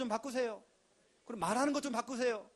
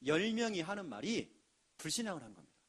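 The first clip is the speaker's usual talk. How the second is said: ordinary talk, nothing marked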